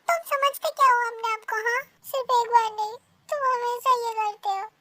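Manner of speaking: loud, high scolding; angry. Acted. Partner scolding him for always doing this, angry shout. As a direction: hurl it back at him.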